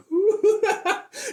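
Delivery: sudden very high squealing giggle. Real, not acted. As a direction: burst into a high-pitched laugh. Laughs mid-quote.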